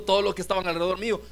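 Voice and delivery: normal talking voice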